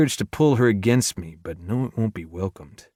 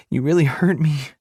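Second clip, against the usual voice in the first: crying; 7 semitones higher, 3 dB louder than usual. A narrator's reading. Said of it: "she cries".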